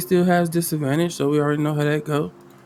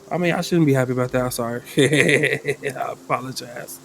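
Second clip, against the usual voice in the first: usual speaking voice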